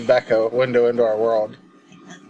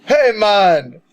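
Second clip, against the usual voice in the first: louder, higher Jamaican take; Jamaican accent; 9 semitones higher, 6 dB louder than usual. Pretend. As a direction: lean into a Jamaican accent greeting. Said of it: "Take three".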